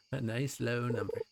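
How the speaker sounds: chuckling through the words; laughing; laughing repeat. Acted. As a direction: laugh at your own joke.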